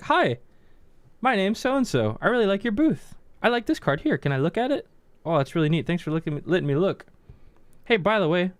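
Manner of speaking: put-on polite kid. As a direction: chirp it like a well-mannered kid.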